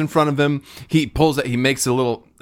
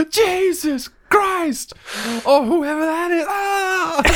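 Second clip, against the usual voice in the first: very high, hammed-up wail; horrified shriek; much slower than usual. Onlooker spattered by guard's insides, horrified shriek. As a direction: shriek high in mock horror.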